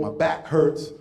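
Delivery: ordinary talk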